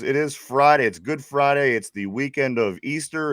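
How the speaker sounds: ordinary talk, nothing marked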